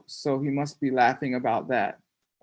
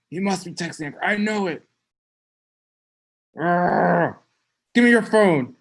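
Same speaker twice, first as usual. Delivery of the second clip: put-on jealous girlfriend; 3 semitones higher, 4 dB louder than usual. Jealous girlfriend imagined, demanding phone.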